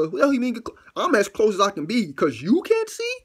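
loud, high-pitched comic outrage; worked-up indignant rant; exasperated protest. Retold or imitated. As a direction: argue back in disbelief. Arguing back at the demand.

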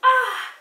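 "ah" called out high and bright. Shown as a demonstration. Teacher leading the class chant, a high "ah". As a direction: pop the "ah" up high. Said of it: "And everyone say sound".

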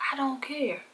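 whispered shrug-off. Retold or imitated. Brushing off her sister's worry, in a whisper. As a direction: breathe it out, dismissive.

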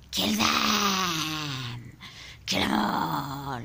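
deep, far-off demon roaring the command; demonic voice shouting. Acted. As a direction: bellow it like a voice from inside the skull.

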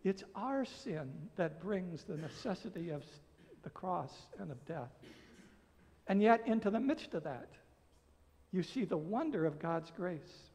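ordinary delivery

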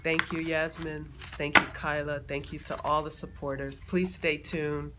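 normal talking voice

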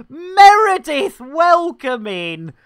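loud, high, drawn-out welcome; big, slow shout-out to a newcomer; greeting a viewer. Real, not acted. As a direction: holler the name as they arrive. Greets arriving viewer by name.

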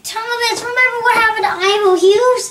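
high, loud pleading; desperate plea. Acted. Percy begging Thomas to remember, desperate loud plea. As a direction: call out urgently to make him remember.

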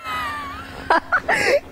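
overwhelmed burst of relief; real tearful laugh; laughing sob. Real, not acted. Reporter cries and laughs after ride.

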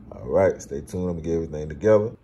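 ordinary talk, nothing marked

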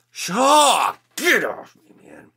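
high, loud mock battle cry; hammed-up excited holler; playful shout. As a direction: yell it as if fending off an attacker.

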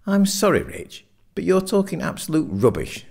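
high-pitched, exasperated protest; exasperated dismissal. A narrator's reading. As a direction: dismiss a mate's argument, voice pitched right up. Simon rejects Richard's argument.

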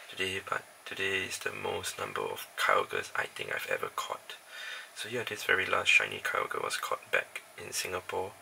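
hushed, near whisper; quiet at night. Real, not acted. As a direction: keep it soft so nobody wakes. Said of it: "I can't talk really loudly because my other roommates are sleeping".

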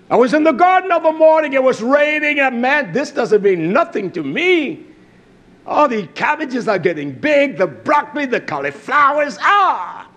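high, ringing sermon voice; fired-up preacher's testimony; exultant preaching. Acted. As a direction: preach the story with rising excitement. Preaches a garden story at force.

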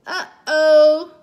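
put-on loud, drawn-out baby "uh-oh"; Baby Louie's uh-oh. A narrator's reading. Baby spots the snowy mess, loud drawn-out alarm. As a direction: sound a big toddler alarm, held long. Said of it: "said Baby Louie".

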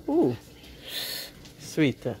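normal talking voice

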